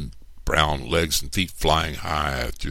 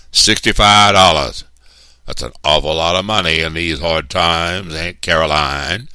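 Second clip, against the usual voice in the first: persuasive boss; 3 semitones higher, 10 dB louder than usual. A narrator's reading. Mister Anderson tempts Big Ma with money, loud and insinuating. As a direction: dangle the money with a loud flourish.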